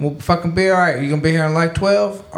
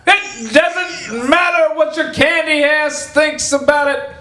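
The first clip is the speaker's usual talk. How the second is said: wrestler promo shout; 11 semitones higher, much slower than usual. Pretend. Joking about an anniversary episode, the wrestler promo shout. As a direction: bellow it like a pro wrestler on the mic.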